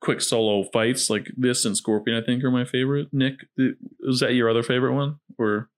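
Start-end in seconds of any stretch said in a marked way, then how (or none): none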